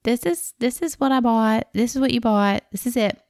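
lightly voiced worker insisting; mimicking the worker. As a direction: hammer home that this is it. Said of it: "And he's like".

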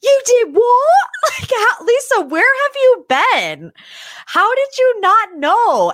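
high, loud, stunned what; shocked disbelief. Retold or imitated. Recapper mimicking Lisa's loud high shock at the Halloween costume. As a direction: gasp it out, can't believe it. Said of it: "And Lisa's like".

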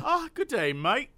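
mock Australian drawl; Australian accent. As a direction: put on a playful Australian accent. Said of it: "That was my Australian".

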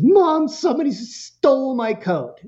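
put-on high whiny kid complaining to mom. Son telling mom his coat was stolen, high whiny kid voice.

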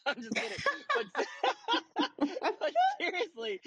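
giggling through the words; laughing. Real, not acted. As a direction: laugh while saying it. Laughing at own joke.

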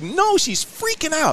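high, slow, exasperated complaint; drawn-out disbelief. Retold or imitated. Exasperated retelling.

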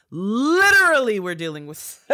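laughing out loud while talking; breaking into real laughter mid-sentence. Real, not acted. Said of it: "I'm not laughing at you".

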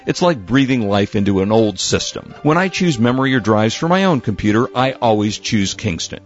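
upbeat host-read commercial. Acted. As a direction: sell it like a radio ad. Host reads sponsor ad.

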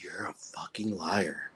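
low, soft whisper of scorn; whispered accusation. Retold or imitated. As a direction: whisper it with contempt. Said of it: "my wife is looking at me like".

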